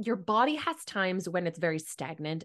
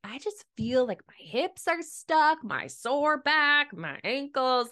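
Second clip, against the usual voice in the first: high, whiny moaning complaint; whiny complaining; 6 semitones higher, slower than usual. Retold or imitated. To her naturopath, a high whiny moan of aches. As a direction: whine it like a sore patient. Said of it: "I sound like a crying".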